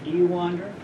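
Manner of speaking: ordinary talk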